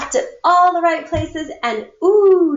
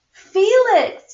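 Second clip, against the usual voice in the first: much higher, loud yell of his name; 10 semitones higher, 5 dB louder than usual. Acted. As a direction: Mom snapping at Felix over the spilled cereal. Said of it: "yelled his mom".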